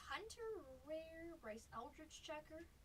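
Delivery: ordinary delivery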